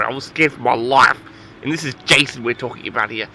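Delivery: ordinary talk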